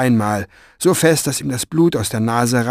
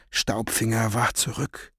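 plain reading voice

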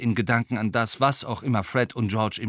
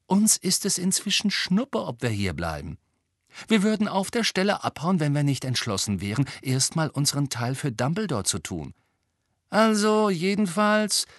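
higher-pitched, brisk twin voice; lively higher voice for the twin; lightly voiced cheeky twin; 8 semitones higher than usual. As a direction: play one of the troublemaking twins, pitched up.

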